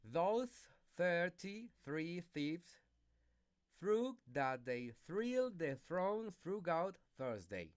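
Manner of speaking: teacher drilling th sounds; slow tongue twister. Shown as a demonstration. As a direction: push the th sounds slowly. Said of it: "pronounce the tongue twisters".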